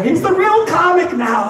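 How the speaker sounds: high, loud, slow proclamation; proud family cheer. Retold or imitated. Family cheering the son home for Christmas. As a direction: crow it proudly. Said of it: "My family was throwing me up".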